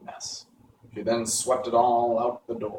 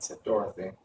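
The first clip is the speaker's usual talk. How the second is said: usual speaking voice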